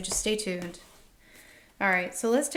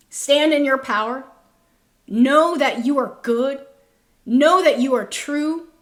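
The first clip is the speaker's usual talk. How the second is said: rousing exhortation; 4 semitones higher, 7 dB louder than usual. Real, not acted. Empowering exhortation to viewers.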